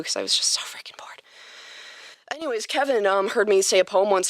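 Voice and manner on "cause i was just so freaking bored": whispered aside. Real, not acted. Aside about being bored.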